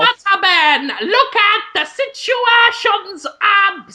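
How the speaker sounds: very high, hammed-up reality-show squawk; mock Jersey Shore confrontation; Jersey Shore impression. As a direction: call out in a silly high Jersey caricature. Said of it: "my impression of the Jersey Shore".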